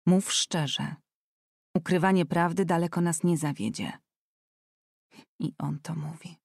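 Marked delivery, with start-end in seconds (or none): 5.4-6.4 breathless private whisper, softer; whispered thought. Acted. Anet whispering scornfully to herself.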